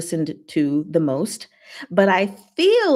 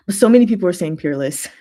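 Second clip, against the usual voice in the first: fast and a bit louder; quick, pressing point; rushed emphasis; 5 dB louder, faster than usual. Real, not acted. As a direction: rattle it off with insistence. Stressing what chat says.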